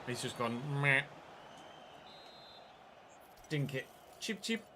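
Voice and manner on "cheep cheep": high, soft little chirp; bird chirp. Pretend. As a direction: peep like a small bird. Imitating chirping birds.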